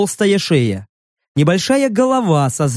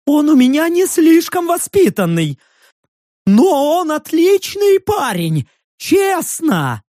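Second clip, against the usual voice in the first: high creature vouching; 10 semitones higher than usual. A narrator's reading. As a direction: vouch for your rude bear, eager beast. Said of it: "Стив пробормотал".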